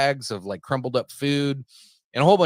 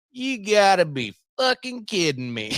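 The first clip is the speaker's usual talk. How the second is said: slow, higher country drawl, disbelieving; thick country accent; 9 semitones higher, 3 dB louder than usual. Retold or imitated. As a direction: imitate a heavy Southern country accent, unimpressed. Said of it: "He sounded more country than anybody".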